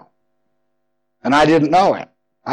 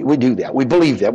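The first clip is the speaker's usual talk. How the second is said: normal talking voice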